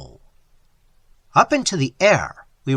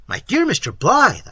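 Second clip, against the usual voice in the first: louder, pleading protest; 7 semitones higher, 5 dB louder than usual. Acted. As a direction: object with rising urgency. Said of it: "I protested".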